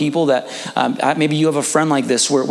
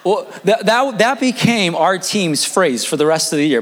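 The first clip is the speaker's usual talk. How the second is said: normal talking voice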